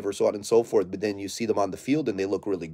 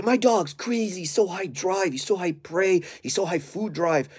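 mocking bragging owner; 8 semitones higher than usual. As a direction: parody the owner bragging about drive. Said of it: "a lot of people say".

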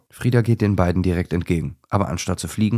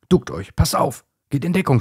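higher, louder warning cry; urgent shout; 8 semitones higher, 3 dB louder than usual. A narrator's reading. Hedgehog warns the dogs of the owl, shouting. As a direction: yell at them to take cover. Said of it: "schreit Igor ganz laut".

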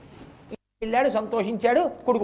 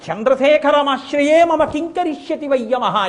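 defiant proclamation; 5 semitones higher, 7 dB louder than usual. Acted. Repeats the defiant verse.

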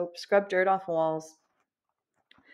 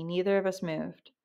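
usual speaking voice

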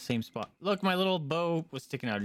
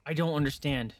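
ordinary talk, nothing marked

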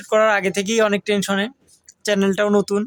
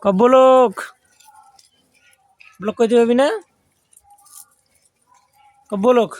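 loud coaching call; 3 semitones higher, 5 dB louder than usual. Real, not acted. Vlogger loudly drilling his goat to say blog, loud call. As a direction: coax a goat to say the word, loud.

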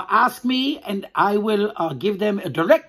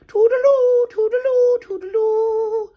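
much higher, sung-out toodaloo, slowing at the end; singsong goodbye; 4 dB louder than usual. Shown as a demonstration. Host singing goodbye to the children, high and lilting. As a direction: lilt toodaloo up high and stretch the last one. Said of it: "Your turn".

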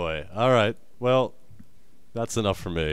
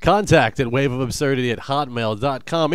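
ordinary delivery

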